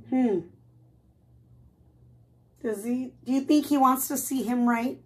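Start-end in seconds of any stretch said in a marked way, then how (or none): none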